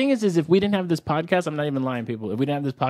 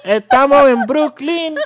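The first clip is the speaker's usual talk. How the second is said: festive Spanish cheer; 8 semitones higher, 8 dB louder than usual. Pretend. Puerto Rican party shout.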